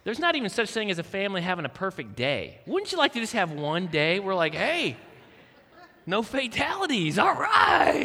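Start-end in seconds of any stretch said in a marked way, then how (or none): 4.5-8.0 mock cheer. Wishing for one calm family day.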